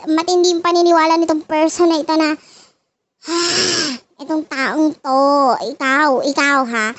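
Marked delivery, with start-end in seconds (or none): 3.3-4.0 audible breathy sigh. Real, not acted. Tarot reader pausing over the person's distrust, sighing. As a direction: let out a heavy breath. Sighs during the reading.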